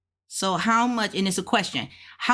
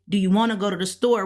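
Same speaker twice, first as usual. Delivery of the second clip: quick question with "wanna" run together; fast everyday English, "wanna go to the" blended; casual spoken form; much faster than usual. Shown as a demonstration. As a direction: rattle off "wanna" as one word. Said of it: "even though we say".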